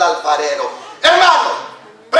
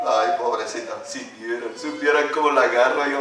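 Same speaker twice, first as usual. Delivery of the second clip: softer, lower smug gloat; mock snob; 9 semitones lower, 6 dB quieter than usual. Pastor imitates a proud musician mocking others, lightly. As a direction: sneer lightly at the struggling player.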